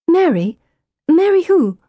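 high, urgent querying; baffled questioning. A narrator's reading. Narrator questions the little girl about a name, baffled.

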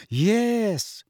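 high, scornful yes; sneering. A narrator's reading. Mother answering the godfather's claim, with a sneer. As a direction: curl the lip on it. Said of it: "her lip curling".

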